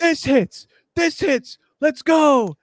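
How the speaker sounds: high-pitched mock cheering; celebrating. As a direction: imitate friends whooping over each win. Voicing group chat celebrating wins.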